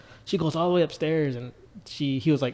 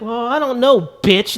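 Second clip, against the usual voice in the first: higher, louder, cheeky delivery; Jimmy's retort; 8 semitones higher, 6 dB louder than usual. Retold or imitated. Jimmy snaps back at Chloe, pitched up and cheeky. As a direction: throw the insult out innocently. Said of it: "just the way he said it".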